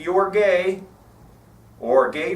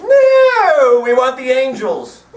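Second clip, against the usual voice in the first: 1 mob shout; 8 semitones higher, 9 dB louder, slower than usual. Retold or imitated. Mob demands the angels.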